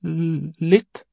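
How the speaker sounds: high, slow, stammered reply; small, hesitant boy voice; timid admission. A narrator's reading. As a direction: stretch the first sound before admitting it. Boy admits fear to monster.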